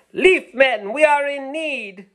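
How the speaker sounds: high, loud plea for help; calling out. Acted. Doodlebug leader begging the Leaf Men for help, loud high call. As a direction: cry out for rescue. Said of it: "he called out".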